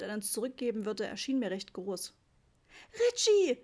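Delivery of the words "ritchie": very high, loud call; panicked shout; mother's scream. A narrator's reading. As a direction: shriek the name for a missing child. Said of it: "schrie Mama wieder".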